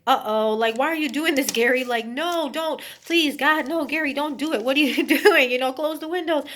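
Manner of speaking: hammed-up panic, pleading with Gary; panicked women. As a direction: beg him to stop in terror. Women panicking as windows open.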